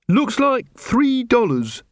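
high, slow character voice; recruit counting money. Acted. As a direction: answer slowly, pitched well up. Angua counts the stolen money.

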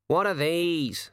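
lightly voiced talking raven. A narrator's reading.

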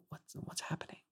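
hushed, baffled whisper; confused whisper. Retold or imitated. Guest recalls whispering among sobbing Filipino ladies, baffled whisper. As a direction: whisper it like you're lost in a room of crying strangers.

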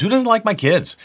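high-pitched, playful incredulity; mock disbelief. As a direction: ask it high, as if utterly baffled. Mock disbelief about neighbour disliking kids.

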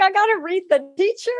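high, fast, mock-panicked whine; nervous student. Student worried about reading her teacher, mock panic. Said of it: "It's like".

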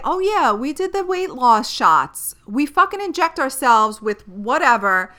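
mocking celebrity voice. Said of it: "If they were to say".

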